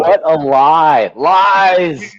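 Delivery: high, loud mock cry of "lies"; mock outrage. Real, not acted. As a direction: call out the lie in a put-on voice. Mocking friend's story, far high.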